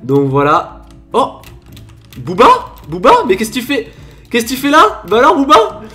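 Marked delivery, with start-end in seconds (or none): none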